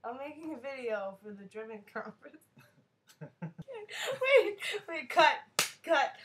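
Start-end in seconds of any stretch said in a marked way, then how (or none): 3.2-6.1 loud laughing cut. Real, not acted. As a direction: giggle while calling cut. Laughing, stopping the recording.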